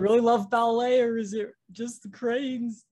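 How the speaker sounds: high, loud, hammed-up whiny Ethan; mock Ethan. Co-host mocking Ethan's identity crisis over cranes, high hammed-up whine. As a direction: play him as a silly self-pitying whiner.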